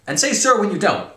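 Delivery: high, loud military command; barked order. A narrator's reading. Major reprimanding cadet, ordering loudly. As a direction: order it sharply at full volume. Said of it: "ordered Major Metcalf".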